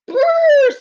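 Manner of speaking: high, loud mock shiver; shivering brrr. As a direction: shake off the cold with a brrr. Badger comes in from cold.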